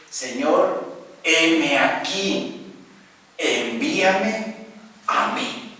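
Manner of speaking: slow, fading to a whisper; Isaiah whispering, envíame a mí; humble offering. Acted.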